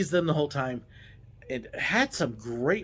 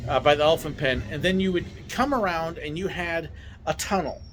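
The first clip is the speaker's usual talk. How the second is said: usual speaking voice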